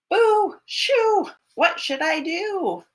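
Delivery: put-on storyteller shoos the bear for the children; shooing call. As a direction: shout to chase the bear away. Shooing the possum.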